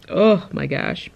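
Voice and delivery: slow loud pained moan; groaning. Real, not acted. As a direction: drag it out in pain. Headache groan.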